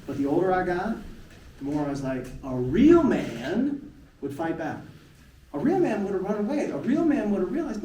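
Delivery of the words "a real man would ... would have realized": self-scolding inner voice. Retold or imitated. Man berating himself for not fighting back, loud. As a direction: hammer yourself with it.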